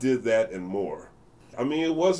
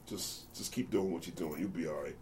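softer, lower, gentle encouragement; quiet reassurance; 5 semitones lower, 11 dB quieter than usual. Retold or imitated. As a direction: murmur comfort to a scared kid. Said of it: "quietly pats me on the back".

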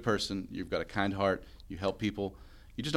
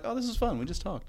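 mock relieved guest; 10 semitones higher than usual. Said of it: "They're like".

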